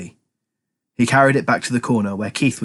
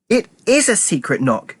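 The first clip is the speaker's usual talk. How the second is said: snapped; 9 semitones higher than usual. A narrator's reading. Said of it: "Militia snapped".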